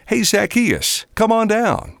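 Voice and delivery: high, friendly call without shouting. A narrator's reading. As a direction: call up to someone in a tree, lightly acted. Jesus calls up to man in tree.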